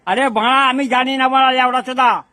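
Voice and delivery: exasperated outburst. Real, not acted. Worn-down man swears at his pestering questioner, exasperated outburst. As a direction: blow up in real frustration.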